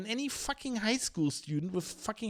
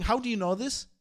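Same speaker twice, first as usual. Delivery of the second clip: higher, louder repeated challenge; the challenge a reporter should make, louder; insistent questioning; 5 semitones higher, 5 dB louder than usual. Retold or imitated. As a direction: press the question hard twice. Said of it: "You're not going".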